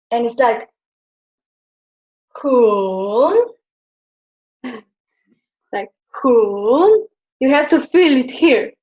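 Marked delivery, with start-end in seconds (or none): 2.4-3.6 hun dipping low and deep then rising; third tone hun. Shown as a demonstration. Teacher demonstrating the dipping third tone, deep then up. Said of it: "starts with deep voice and goes up". 5.7-7.1 hun dipping deep then rising; third tone hun. Shown as a demonstration. Teacher repeating the dipping third tone for the student. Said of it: "starts with deep voice and goes up".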